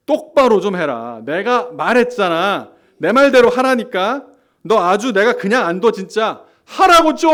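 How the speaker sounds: slow, loud, rising to a furious high yell; forceful demand. Acted. Pastor acting out someone forcing their will on another, building to a furious yell. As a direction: hammer each order harder until it explodes. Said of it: "한번 실감나게 한번 해보겠습니다".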